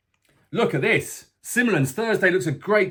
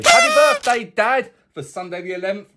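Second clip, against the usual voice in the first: birthday greeting; 4 semitones higher, 5 dB louder than usual. Real, not acted. Birthday wish to his dad.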